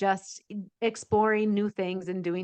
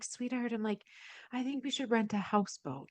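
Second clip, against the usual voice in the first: soft sweet suggestion; 2 semitones higher, 9 dB quieter than usual. Retold or imitated. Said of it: "And I'm like".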